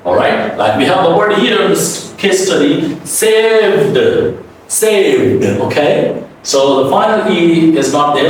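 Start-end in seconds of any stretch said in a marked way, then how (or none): 3.0-5.4 'saved' with a released voiced D; high-pitched model 'saved', ending in a buzzed v and D. Shown as a demonstration. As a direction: say 'saved' as sayv-d. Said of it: "speak the final D as an".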